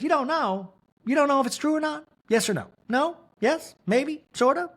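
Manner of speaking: high, fast, pushy mother grilling her son; mock interrogating mother. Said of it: "And Mary goes".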